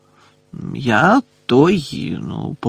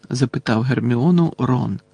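ordinary delivery, nothing marked

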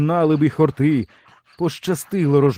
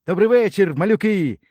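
raised, happy greeting from a grown-up; delighted like a child; 7 semitones higher than usual. A narrator's reading. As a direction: greet the little ones gladly, pitched up. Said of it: "і зрадів, немов дитина". (радісне, піднесене привітання дорослого)

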